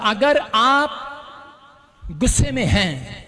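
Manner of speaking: drawn out, very slow; slow emphasis. Real, not acted. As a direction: stretch each word out slowly. Preacher slows for emphasis. (बहुत धीरे, खींचकर)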